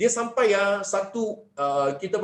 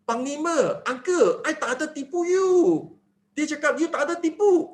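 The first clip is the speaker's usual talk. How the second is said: put-on innocent boy; 7 semitones higher than usual. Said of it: "Budak cakap".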